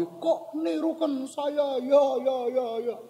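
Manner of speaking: high, slow echo with repeats dying away; drawn-out echoing call, trailing off; echo answering back. Retold or imitated. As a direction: imitate a ravine echo, stretched and fading. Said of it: "Terus dijawab lagi".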